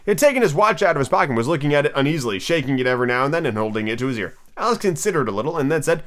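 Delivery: ordinary delivery, nothing marked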